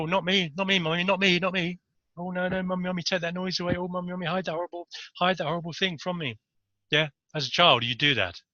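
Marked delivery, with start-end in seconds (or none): 0.0-1.8 put-on high, pleading little child voice; whiny child. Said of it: "they go". 2.2-6.4 put-on high, soft whimpering child pleading; scared child. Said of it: "they go".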